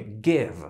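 "Give" with a short, relaxed i; "Give" said like the Polish y. Shown as a demonstration. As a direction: say "Give" with a short i, not "gieev". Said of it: "mówić bardziej jako y".

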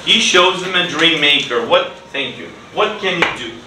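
ordinary talk, nothing marked

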